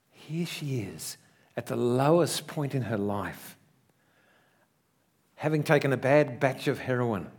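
usual speaking voice

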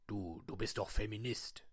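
low, slow put-on voice; disguised deep voice. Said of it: "meine Stimme zu verstellen".

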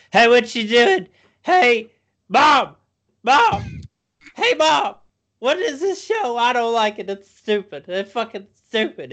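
very high, loud dim-witted dog voice. Retold or imitated. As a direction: squeal it out like an obnoxious moronic dog. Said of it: "this is how he sounds".

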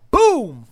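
high, loud sound-effect boom. Acted. Guy falling face first, loud high boom. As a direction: punch out the boom.

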